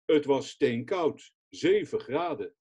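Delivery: ordinary talk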